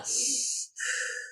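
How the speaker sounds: long soft breath out; slow exhale. Shown as a demonstration. Showing viewers how to calm down, a long exhale. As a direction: breathe it out slowly. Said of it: "let me breathe this out".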